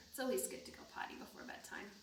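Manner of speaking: quick breathy whisper; whispered aside to the children; fast whisper. Acted. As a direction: rush it out in a hush. Horse's bedtime line read aloud.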